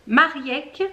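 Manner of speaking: normal talking voice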